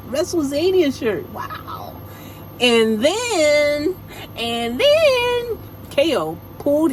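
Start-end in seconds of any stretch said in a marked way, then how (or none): 1.3-2.0 whispered wow. Real, not acted. Reacting to shirt.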